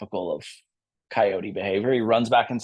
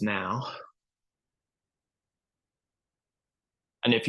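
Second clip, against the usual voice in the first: calm, unhurried yoga cue voice; a soothing yoga teacher easing students into stillness; gentle yoga instruction. Real, not acted. Yoga teacher guiding toward Shavasana.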